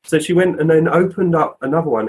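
plain reading voice

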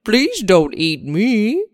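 slow, pleading little worm; worm puppet voice. Acted. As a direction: beg not to be eaten as a puppet. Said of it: "it's a hand puppet".

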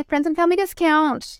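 high, put-on salesy offer; mock sales offer. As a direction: offer it like an awkward seller. Acted sales offer, then denied.

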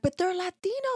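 put-on hurt child trying to make sense of it; son's voice. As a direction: ask it like the puzzled boy. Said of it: "he was like".

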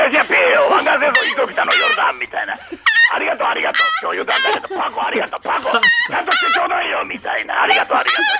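a frantic, squealing comedian's thank-you; Hayashiya Pei impression. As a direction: yell it breathlessly, over the top like Pei. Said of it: "ペイさん".

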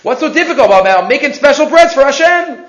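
imitated keen enthusiasm; eager resolve. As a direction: play a simple man sure it is easy. Simple man's eager resolve.

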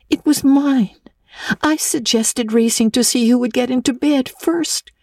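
put-on high eager girl's voice; light, high girlish voicing; young Anne. A narrator's reading. Anne confesses the bed race, high girlish voice.

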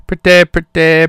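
mock emphatic. Emphatic catchphrase repeat of pretty.

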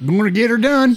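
high, loud hillbilly catchphrase; Larry the Cable Guy. Retold or imitated. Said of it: "Okay, Larry the Cable Guy".

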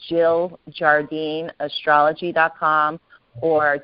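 slow, careful web address; slow dictation. Shown as a demonstration. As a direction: read the address slowly so listeners can note it. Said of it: "So I have two websites".

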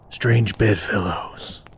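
low, slow, weary warrior voice; gruff warrior. Acted. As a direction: drop low and slow, like a battle-worn fighter. DM voices Kane.

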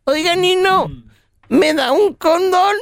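put-on high, slow little boy voice; high-pitched childish request; little godson. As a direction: ask like an innocent small child. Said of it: "Pues le, le dice".

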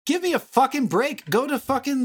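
exasperated. Real, not acted. Exasperated rant about filming locations.

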